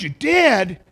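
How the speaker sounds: loud, high mock-astonished exclamation; amazed retelling. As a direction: act out a big, incredulous reaction. Retold amazed reply, louder second take.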